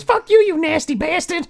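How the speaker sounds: high mock woman's yell. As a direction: imitate her parting insult. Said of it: "she's yelling at me".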